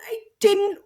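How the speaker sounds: high, slow, choked-up mock wail; retold tearful wail. Writer tells husband a character just died, high slow tearful mock wail. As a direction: replay her own late-night crying, drawn out and high. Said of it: "I'm just crying".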